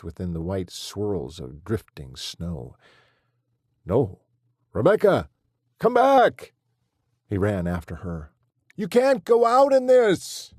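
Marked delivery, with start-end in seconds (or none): 3.9-10.5 very loud, much higher shouts after the car; desperate shouting. Acted. Husband shouting after his wife driving off into the blizzard, desperate yells. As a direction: scream after her into the storm. Chasing her car in snow.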